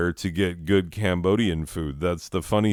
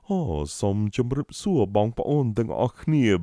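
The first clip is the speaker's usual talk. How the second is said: a light, slightly higher put-on voice of a traditional Cambodian man chatting in Khmer; Cambodian elder; 4 semitones higher than usual. Imitating how a Cambodian man should sound, in a lightly put-on Khmer voice. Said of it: "he should be like".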